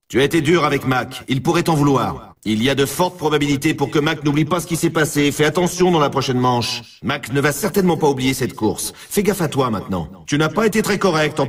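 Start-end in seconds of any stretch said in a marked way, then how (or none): none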